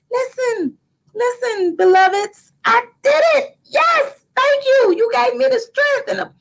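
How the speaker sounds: high-pitched jubilant thanks; triumphant proclamation. Acted. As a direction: proclaim the victory in a high, exultant voice. Voices a triumphant thanks to ancestors.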